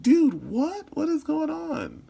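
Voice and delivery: very high, incredulous outburst; shocked. Acted. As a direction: act out baffled onlookers, stretching the question.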